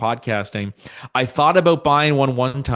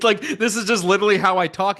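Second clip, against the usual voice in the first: higher, quick words through laughter; laughing protest; 7 semitones higher, faster than usual. Real, not acted. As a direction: insist with an amused laugh. Laughing protest about his loud voice.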